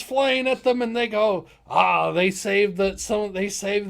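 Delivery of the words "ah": ordinary talk, nothing marked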